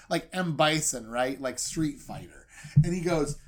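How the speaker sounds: ordinary talk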